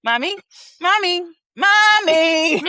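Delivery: slow, drawn-out high calls rising in pitch; imitating a child's changing inflection. Friend showing the daughter's changing inflection, slow and high. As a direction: let each mommy climb like a toddler's call. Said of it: "Oh, she changed her inflection".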